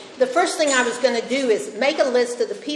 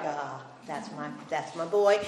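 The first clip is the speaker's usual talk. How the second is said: tender mother; 6 semitones lower, 9 dB quieter than usual. Retold or imitated. Mother murmuring proudly over her baby son, soft and tender.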